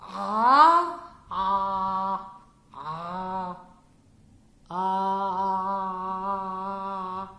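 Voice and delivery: Ah pressed out through a squeezed throat, fading; choked ain sound. Shown as a demonstration. Teacher demonstrating the throat-press trick. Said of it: "let's do it".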